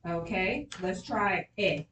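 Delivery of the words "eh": "eh" said as a lone short vowel; sounding out. Shown as a demonstration. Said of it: "can we sound it out".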